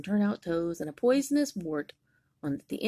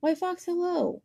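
very high, bright greeting; cheery mouse; 12 semitones higher, 3 dB louder than usual. Acted. Mouse greeting Snake, very high cheery hello. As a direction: sing out a sweet hello. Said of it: "said the Mouse".

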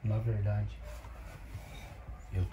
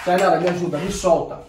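louder, agitated call; urgent demand; 4 semitones higher, 7 dB louder than usual. Acted. Man calling his carer to free him, urgent demand. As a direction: call out for help while pulling free. Pulls away, calls carer for help.

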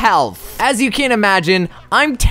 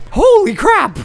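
startled exclamation; 12 semitones higher than usual. Real, not acted. Sudden shock in boss fight.

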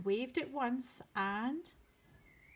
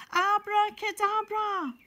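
loud magic word, pitched up; magic shout; 8 semitones higher, 8 dB louder than usual. Shown as a demonstration. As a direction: call it out like a party magician. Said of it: "Shall we say it after three?".

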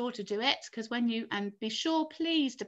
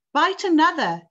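raised, higher commanding voice; ordering; 7 semitones higher, 9 dB louder than usual. A narrator's reading. James to the centipede biting the strings, ordering. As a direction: call out a firm order, louder and higher. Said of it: "James ordered".

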